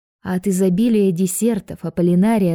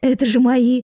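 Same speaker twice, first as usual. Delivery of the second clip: higher, drawn-out embarrassment; an awkward, embarrassed little exclamation; flustered; 5 semitones higher, slower than usual. A narrator's reading. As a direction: sound caught out and embarrassed. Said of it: "сконфузилась Аполлинария".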